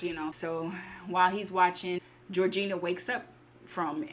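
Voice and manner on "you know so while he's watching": ordinary delivery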